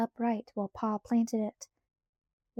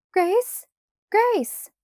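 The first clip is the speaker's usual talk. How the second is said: high, loud calls, the second higher still; calling out; 10 semitones higher, 8 dB louder than usual. A narrator's reading. Ma calls for her missing child, high and loud. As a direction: call a missing child's name. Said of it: "and she called".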